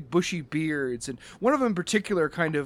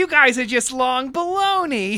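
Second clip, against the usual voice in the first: higher, louder playful jeer; playful schoolyard insult, raised and loud; mock taunt; 8 semitones higher, 6 dB louder than usual. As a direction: tease them like a kid insulting a rival. Mock trash talk between gangs.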